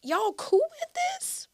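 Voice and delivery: mock outraged squeak; incredulous. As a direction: ask it as if nobody else is sane. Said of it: "I'm just like".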